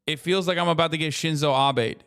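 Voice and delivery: ordinary delivery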